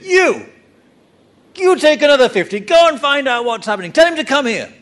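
high, loud, fast angry command; angry royal order. Retold or imitated. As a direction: bark orders like a furious king. Angry king orders soldiers.

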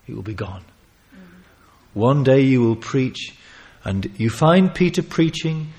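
normal talking voice

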